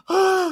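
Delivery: high-pitched imitated sobbing gasp; loud, high mock wail after a gasp; mock crying gasp. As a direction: gasp and wail like a tearful caller, hammed-up. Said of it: "she's in tears crying".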